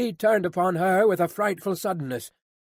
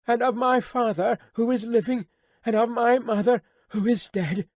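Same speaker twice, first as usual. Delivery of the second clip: put-on higher, earnest, tearful young woman; pleading young daughter; 6 semitones higher than usual. A narrator's reading. Daughter pleading to her father, higher and earnest. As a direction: beg him with all your love.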